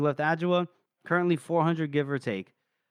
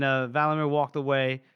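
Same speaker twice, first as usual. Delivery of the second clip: normal talking voice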